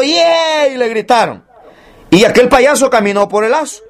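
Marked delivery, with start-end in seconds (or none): 0.0-0.7 high, drawn-out cheer; imitated crowd cheer. Preacher mimicking the crowd cheering a tightrope clown, mock cheer. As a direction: mimic an audience's long high "yeah". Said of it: "todo el mundo gritó".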